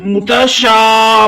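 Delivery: मुतशा said very slowly with the final आ stretched; slow recitation demo of मुतशा; drawn-out madd. Shown as a demonstration. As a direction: hold the आ in मुतशा for a full beat. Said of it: "एक अलिफ की मकदार खींच कर पढ़ेंगे".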